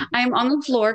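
ordinary talk

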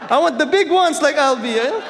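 high, loud mock complaint; playfully petulant outburst; comic envious whine. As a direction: demand it like a jealous kid, for laughs. Acted envious complaint, played for laughs.

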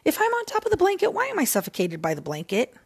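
fast, high exasperated rant; incredulous. Real, not acted. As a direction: rush it out in disbelief. Arguing with herself, incredulous.

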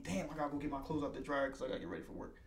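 low, quick, soft grumble to oneself; retold grumble. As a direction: imitate a resigned rushed complaint under your breath. Retold Sunday grumble about work chores.

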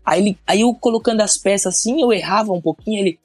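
ordinary talk, nothing marked

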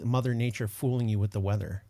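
ordinary delivery

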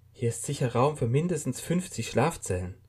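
muttering lost and disoriented; confused man. A narrator's reading. Waking man asks himself where he is, bewildered.

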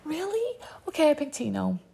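high, mocking girly voice as Rachel. Host mocking Rachel's imagined reply, high and sarcastic. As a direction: mimic her quick flip to the other guy. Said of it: "She would have been like".

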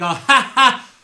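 loud, high delighted cackle; giddy laugh. Acted. Trina laughs out loud at the lucky one. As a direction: burst out laughing at the lucky roll. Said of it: "she's like".

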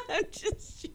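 high, words squeezed out through laughter; helpless laughing. Real, not acted. Co-host taking back her racism jab, helpless laughing. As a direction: giggle your way through the excuse.

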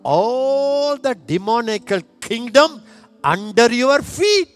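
high-pitched, insistent sermon delivery; forceful preaching. Real, not acted. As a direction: drive each point home to the congregation.

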